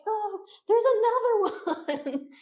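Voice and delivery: high, drawn-out mock wail; retold shock breaking into a laugh; alarmed discovery. Hikers spotting another rattlesnake right next to them, laughing mock alarm.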